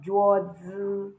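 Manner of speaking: "juozi" with zi held long and level; wrong first tone. Shown as a demonstration. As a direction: slowly stretch both syllables of "juozi" evenly. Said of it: "but Z I has no tone".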